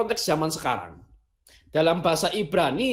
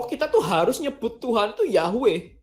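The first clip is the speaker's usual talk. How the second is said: higher, insistent voice of the purists; imitating those who insist on Yahweh, raised and emphatic; mock insistent believer; 6 semitones higher than usual. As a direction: lecture them that only Yahweh is allowed. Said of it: "yang menganggap bahwa".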